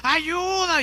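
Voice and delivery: high desperate cry for help; stranded man's high call for help. Acted. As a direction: yell up the cliff for rescue. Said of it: "comenzó a gritar con todas sus fuerzas".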